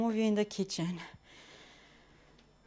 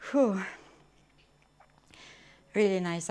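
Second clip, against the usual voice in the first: ordinary talk, nothing marked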